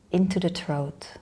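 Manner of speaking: unhurried meditation guide with long pauses; guided meditation. Real, not acted. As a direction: lead them inward in short, spaced-out phrases.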